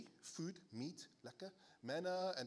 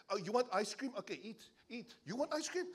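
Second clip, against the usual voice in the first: exasperated parent; 7 semitones higher, 4 dB louder than usual. Retold or imitated. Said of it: "Any parents who feel like that?".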